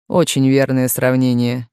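low, understated bitter irony; bitter smirk. A narrator's reading. Client agreeing with his lawyer's comparison, mildly bitter. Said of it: "согласился Лестер с горькой усмешкой".

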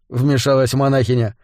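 plain reading voice

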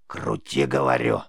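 low, creaky impatient command; insistent witch rasp. A narrator's reading. Witch repeats her order to the boy, insistent low rasp. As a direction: press the order in a low croak.

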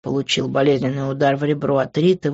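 plain reading voice

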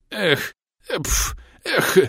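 high strangled sputters and gasps; choked sputtering. Acted. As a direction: splutter as if doused with cold spray. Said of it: "придушённо произнесло тело".

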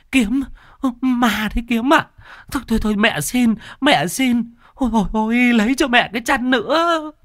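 high panicked begging; wife panicking at the ghost, begging for a blanket; terrified pleading. Acted. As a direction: cry out to your son in terror. Bà Sử sees the ghost, begs.

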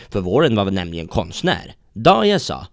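ordinary talk, nothing marked

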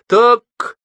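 высокое громкое удовлетворённое восклицание; отметь это с довольной угрозой; злорадное удовлетворение. A narrator's reading. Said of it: "сказал он с удовлетворением". (с громким удовлетворением)

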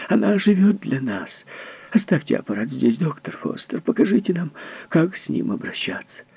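high, eager, pleading. Acted. Mother begs Foster to leave the machine, eager. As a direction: beg to see the lost daughter.

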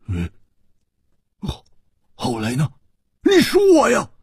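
stammer, then burst out high and loud; halting start exploding into an urgent demand; can't wait to hear the rest; impatient, unable to hold back. A narrator's reading. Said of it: "忍不住说".

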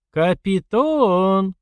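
long, high drawn-out call. A narrator's reading. Sister hailing the fight organiser, calling out high and long. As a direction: hail someone far off with a stretched name. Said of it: "позвала Лерка организатора боёв".

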